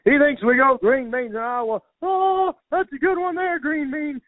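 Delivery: very high-pitched, loud mocking farmer voice; mocking Iowa farmers. Farmers laughing at the comedian over his dumb question, high hammed-up mockery. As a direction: hoot it up like farmers ridiculing an outsider. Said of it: "they all started laughing at me".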